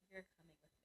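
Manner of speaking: very soft, barely voiced command; hushed retold order. Work husband holds her hand in the parking lot, hushed order. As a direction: imitate him taking charge under your breath.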